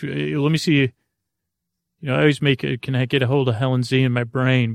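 usual speaking voice

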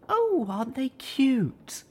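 high, slow cooing voice. A narrator's reading. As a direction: coo it sweetly. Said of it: "Velvet cooed".

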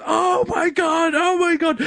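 high, drawn-out mock wail; imitated horrified reaction. Sal watching dog mess eaten, horrified wailing. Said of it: "Sal's instant reaction is".

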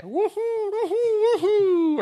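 very high, drawn-out falsetto whooping; imitated Mario whoops. Strange noises drifting up to a child in bed, high whooping. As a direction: mimic strange game noises heard from upstairs. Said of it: "these really strange noises".

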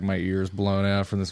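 ordinary talk

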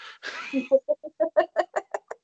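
long, high-pitched giggle; high laugh. Real, not acted. Laughs at complaint about accent.

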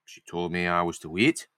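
put-on vague northern accent. Lizzie explains how he took her away, vague northern accent. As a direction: recount it in a roughly faked northern accent. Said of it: "go for a vague northern accent".

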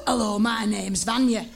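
low butch demo voice; big butch Vanya. Shown as a demonstration. Host shows volunteer how to play Vanya, butch voice. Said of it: "Can you do it right like that?".

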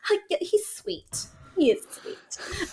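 soft, high, strained cooing; mock-sweet, very quiet and drawn out; awkward fake niceness. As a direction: back down in a small high voice. Fake reassurance to a friend, awkward.

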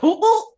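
high, loud imitated choking gasps; mock choking. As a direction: play the woman choking on a strong shot. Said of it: "and she was just like".

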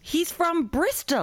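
high, slow disbelieving exclamation; incredulous. Real, not acted. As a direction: draw it out, amazed at the discovery. Discovers fake Welshman's real origin.

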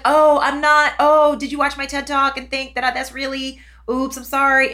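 high, loud flustered backtracking; mock embarrassed apology. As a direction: apologise as the misunderstanding dawns. Acts own embarrassed apology.

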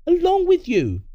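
very high, loud interjection; sharp outburst. Acted. Parent cutting in on the threats, sharp outburst. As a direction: burst in on the threat.

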